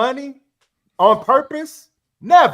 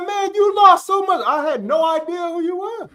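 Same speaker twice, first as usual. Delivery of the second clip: amazed; 10 semitones higher than usual. Retold or imitated. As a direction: marvel at the change. Friend amazed at weight loss.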